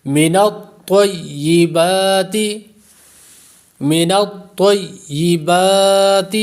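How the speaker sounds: من الطیبات recited slowly, heavy ta and a long ba; tajweed recitation. Shown as a demonstration. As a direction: give ta weight and stretch ba in من الطیبات. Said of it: "لمبا کریں گے".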